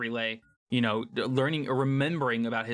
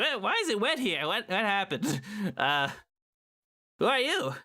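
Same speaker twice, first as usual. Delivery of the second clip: high, bewildered voice; baffled skeleton waking up; confused; 7 semitones higher than usual. Acted.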